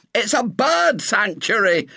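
high, slow, indignant shriek; shrill it out, stretching the words. A narrator's reading. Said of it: "shrilled the young man".

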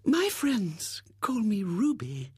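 slow, whispered, intimate confidence; confidential whisper. Acted. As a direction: breathe it like a coy secret. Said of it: "in low, confidential tones".

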